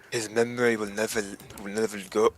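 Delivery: character speech slipping into Scottish; lightly Scottish, by mistake; a hint of Scottish creeping in; accidental Scottish accent. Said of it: "I'm turning Scottish".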